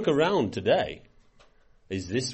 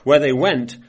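louder, measured scripture read; 3 semitones higher, 5 dB louder than usual. Acted. Preacher reading Ezekiel to his congregation, solemn read. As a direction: read the verse out with weight.

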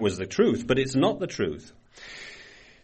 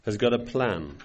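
ordinary talk, nothing marked

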